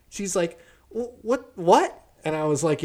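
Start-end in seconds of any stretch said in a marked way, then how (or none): 0.9-2.0 high stammering mock disbelief; stunned boss. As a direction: stammer in shock. Boss voiced in retold quarrel.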